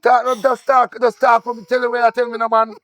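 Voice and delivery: high, urgent pleading; frantic urging. Acted. Begging the crying woman to talk, frantic.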